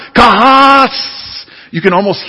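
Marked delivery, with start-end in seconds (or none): 0.1-0.9 Kahas called out higher, mock angry; hammed-up angry Khan cry of kahas. Pastor imitating an angry Star Trek villain, mock furious cry. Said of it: "He says".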